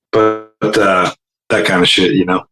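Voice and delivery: usual speaking voice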